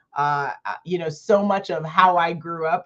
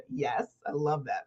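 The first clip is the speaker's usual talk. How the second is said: ordinary delivery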